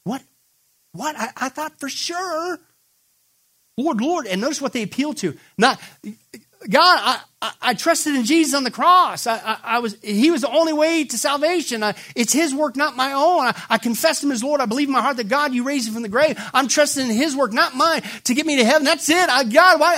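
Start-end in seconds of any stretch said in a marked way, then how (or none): 0.1-4.2 rising, much higher stunned cry; shocked disbelief. Acted. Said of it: "They're literally shocked". 6.7-19.9 loud, much higher anguished plea; desperate pleading. Acted. Believers plead on judgment day.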